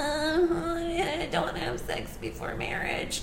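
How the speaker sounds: very high, slow, soft whine; whiny mom impression. Son mocking his mom's prim voice, a high slow whine. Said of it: "That was my mom".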